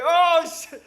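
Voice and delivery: sudden loud, high cry of fright; startled shout. Retold or imitated. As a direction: yell it as the dog lunges at you. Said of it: "yo dije". (grito de susto, fuerte y agudo)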